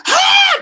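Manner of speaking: imitated high, loud scream; sudden scream. Retelling a silly video to the team, sudden scream. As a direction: let out an abrupt mock scream. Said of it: "Just screams out loud".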